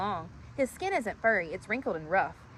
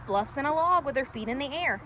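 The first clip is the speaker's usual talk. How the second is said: plain reading voice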